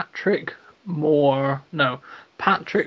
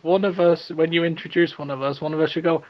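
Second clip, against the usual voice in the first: ordinary talk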